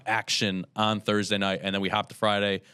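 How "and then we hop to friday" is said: ordinary delivery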